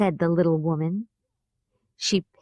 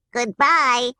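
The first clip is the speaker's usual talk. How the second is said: louder chorus of little Munchkins; cheerful Munchkin goodbye, a touch louder; 4 semitones higher, 4 dB louder than usual. Acted. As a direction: call goodbye as small Munchkins together. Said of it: "said the three little Munchkins".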